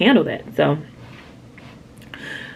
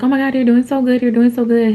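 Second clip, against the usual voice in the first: much higher, louder, gushing cheer; excited cheering; 8 semitones higher, 7 dB louder, faster than usual. Retold or imitated. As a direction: cheer her on like the nurses did. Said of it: "they're like".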